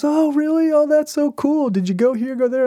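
very high-pitched imitation of an excited wife; gush, then eagerly fire off questions; delighted interest. Wife hearing of an anniversary trip, delighted interest. Wife's delighted reply, retold.

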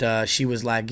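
ordinary talk

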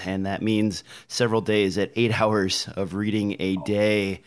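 usual speaking voice

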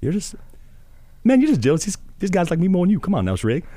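pleading sidekick to his grumpy friend, in Donkey's voice; Donkey impression. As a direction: chatter at Shrek like an eager sidekick. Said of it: "Shrek and Donkey".

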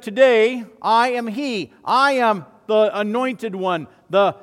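Jesus declares himself Messiah in the synagogue; Jesus proclaiming. Retold or imitated. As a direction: thunder out the claim to be Messiah. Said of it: "And he said".

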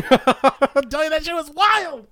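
very high, slow goofy voice; silly clown. Pretend. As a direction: squeak it out like a clown. Jokey sign-off banter.

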